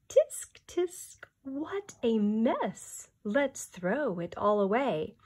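tsk-tsk like a fussy kangaroo; tutting kangaroo. Acted. Kangaroo voiced in story.

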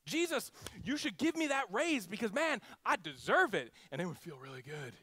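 high, loud mock-entitled demands; demanding prayer. Believer demanding Jesus fire the boss, mock-entitled and loud. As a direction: whine your wishlist at Jesus.

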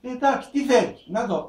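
Jesus asking. Retold or imitated. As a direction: ask him kindly what he wants. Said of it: "και του λέει".

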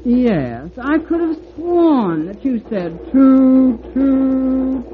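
flattering gush with a mock toot. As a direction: gush insincerely, hooting toot high. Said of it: "gushing insincere manner".

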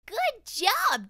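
high, loud praise; praising teacher. Acted. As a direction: cheer him on like a teacher. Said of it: "David's teacher always said".

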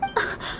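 a soft, defeated sigh, barely voiced; a quiet sigh of giving in; resigned sigh. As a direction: let the air out in quiet resignation. Resigned after being told off.

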